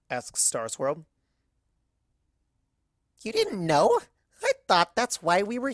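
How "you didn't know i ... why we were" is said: high, loud and bouncy; an excitable party pony gushing; much higher and louder, eager; Pinkie's bubbly voice. Acted.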